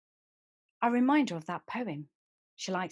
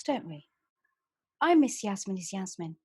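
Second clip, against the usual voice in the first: ordinary delivery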